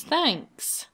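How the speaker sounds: ordinary talk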